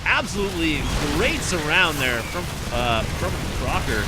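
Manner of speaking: loud, driving esports commentary; live play-by-play. Real, not acted. Caster narrating the battle to viewers, loud driving call. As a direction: keep the energy up through the fight.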